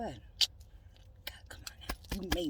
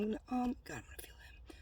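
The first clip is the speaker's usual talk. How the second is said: whisper; 4 semitones higher, 15 dB quieter, faster than usual. Real, not acted. Whispered excitement about meeting.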